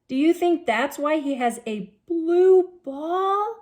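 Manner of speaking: plain reading voice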